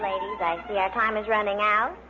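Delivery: normal talking voice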